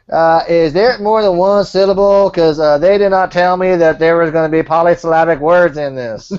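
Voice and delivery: mock bafflement at long words, loudly drawn out; slow-witted complainer.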